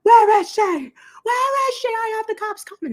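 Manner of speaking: very high-pitched mock yelling; imitated yelling. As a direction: mimic a woman yelling across a restaurant. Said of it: "starts yelling".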